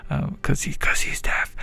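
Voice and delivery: whispered aside; whispering. Real, not acted. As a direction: whisper it behind your hand. Aside about deaf chatter.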